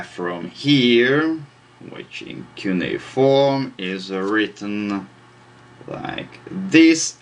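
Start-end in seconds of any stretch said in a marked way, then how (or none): none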